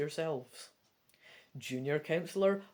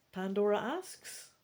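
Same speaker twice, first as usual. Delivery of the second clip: normal talking voice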